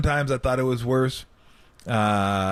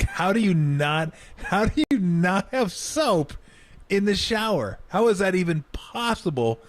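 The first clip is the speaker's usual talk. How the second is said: incredulous; 8 semitones higher than usual. Real, not acted. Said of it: "disbelief about no soap".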